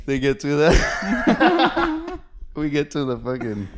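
slow, high, laughing words. Real, not acted. Friend laughing while telling his story, slow and high. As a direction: let the laugh stretch every word.

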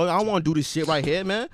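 ordinary talk, nothing marked

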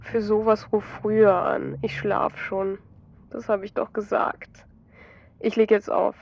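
drifting off, wants to hang up; groaning sleepily. A narrator's reading. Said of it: "Ein Stöhnen kommt von Christoph zurück". (schläfrig stöhnend, viel leiser)

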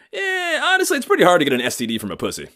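high, loud and fast bro voice; breezy dude doctor, tossing it off; cool young doctor. Retold or imitated. Cool young doctor casually reassuring his patient, high and loud. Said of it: "he goes".

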